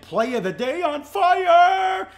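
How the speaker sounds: rising to a loud, drawn-out shout; hyped-up show tease. Real, not acted. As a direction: sell it like a hyped radio host. Host hypes his show's picks.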